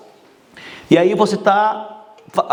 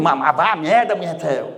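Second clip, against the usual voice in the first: friendly insult. Shown as a demonstration. Speaker showing an insult can please the listener, said playfully. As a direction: tease warmly while telling them off. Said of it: "te mandar a merda e tu ficar feliz comigo".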